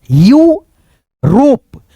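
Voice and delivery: "यूरोप" stretched very slowly with a rise; drawn-out model word. Shown as a demonstration. Teacher models the word for students, very slow and stretched. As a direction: spell out "यूरोप" slowly for the class. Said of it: "इसको पढ़ेंगे यूरोप".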